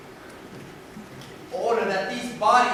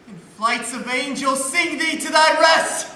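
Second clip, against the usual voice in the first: grief-stricken shout; 6 semitones higher, 5 dB louder than usual. Acted. Horatio cries out in grief.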